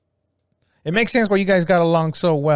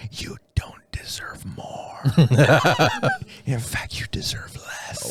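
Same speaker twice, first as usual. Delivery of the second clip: breathy whispered into an ear; 4 dB quieter, slower than usual. Pretend. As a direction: whisper it close and slow. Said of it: "at night you whisper in her ear".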